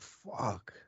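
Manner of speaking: very soft retold curse; quiet, understated dismay; hushed realisation. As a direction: swear softly as it sinks in. Retold moment it finally clicked.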